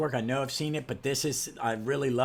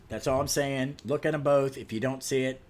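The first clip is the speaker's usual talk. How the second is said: usual speaking voice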